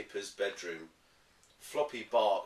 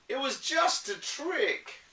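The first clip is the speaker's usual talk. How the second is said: put-on gleeful child; 6 dB louder than usual. Kipper gleeful.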